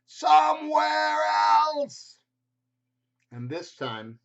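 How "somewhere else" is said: high, loud, slow outburst; shouting. Acted. Frustrated Gerald blurting out at the birds, shouting. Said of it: "Gerald shouts so loud".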